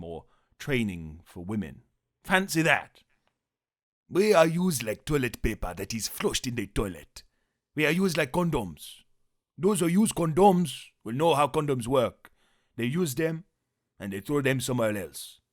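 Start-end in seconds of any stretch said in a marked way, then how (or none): 4.1-15.4 loud, imitating a political speech; fiery rally speaker voice; Julius Malema impression. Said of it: "Familiar with that one?".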